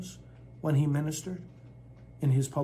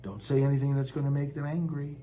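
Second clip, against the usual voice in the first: usual speaking voice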